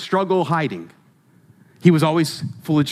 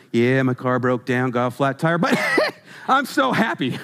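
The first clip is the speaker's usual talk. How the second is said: laughing coworker; 7 semitones lower than usual. Pretend. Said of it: "Imagine showing up to work".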